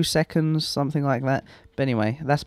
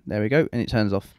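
ordinary talk

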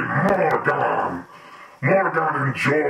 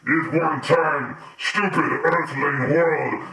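usual speaking voice

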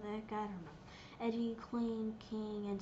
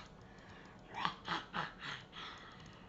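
soft, sinister breathy laugh; a villain's quiet snicker; whispered evil giggle; evil whispered chuckle; 9 semitones lower, 8 dB quieter than usual. Pretend. After creepy whispered threat.

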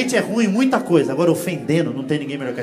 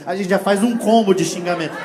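ordinary delivery

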